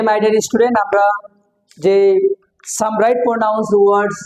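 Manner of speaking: usual speaking voice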